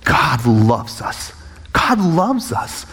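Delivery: slow, heartfelt declaration; understated emotion, very drawn out; retold tearful praise. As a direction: declare it slowly, savouring each word. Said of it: "as I'm bawling".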